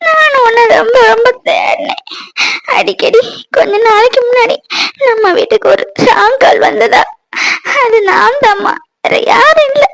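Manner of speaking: high, sobbing plea for forgiveness; crying. Acted. Daughter begging her mother's forgiveness for leaving, crying hard. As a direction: weep through the whole confession. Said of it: "அழாத சத்யா".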